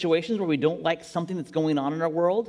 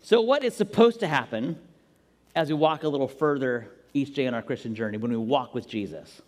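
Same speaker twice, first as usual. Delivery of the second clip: normal talking voice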